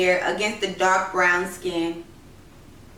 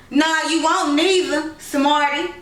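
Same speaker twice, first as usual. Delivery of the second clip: put-on teasing boy; 6 semitones higher than usual. Children squabble over shaving.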